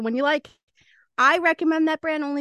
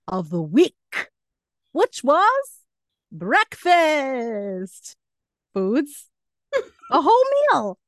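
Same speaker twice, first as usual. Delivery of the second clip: drawn-out, swooping game-show announcement; over-the-top segment intro, pitch leaping about; hammed-up announcer; 3 semitones higher, 4 dB louder, slower than usual. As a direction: stretch the key words with mock grandeur.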